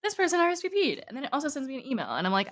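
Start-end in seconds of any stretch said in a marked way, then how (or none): 0.0-1.0 notification voice. Retold or imitated. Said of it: "it's like".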